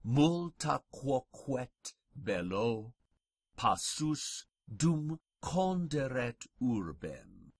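slow, deliberate Latin, phrase by phrase with pauses; measured pronunciation model, unhurried and carefully spaced; very slow recitation. Shown as a demonstration. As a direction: recite very slowly so learners can follow along. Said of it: "I'll go very slowly".

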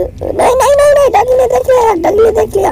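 very high, fast panicked cry; panicked squeal. Real, not acted. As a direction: squeal in fear, the dog saw you. Dog spotted him in game. (घबराई हुई तीखी चीख़)

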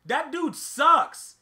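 P=A; drawn-out insult. Real, not acted. Repeating the put-down louder.

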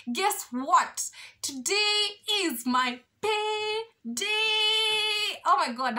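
high, drawn-out sing-song announcement; excited. Real, not acted. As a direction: sing out the big news slowly. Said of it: "I'm so excited".

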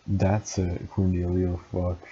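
ordinary delivery, nothing marked

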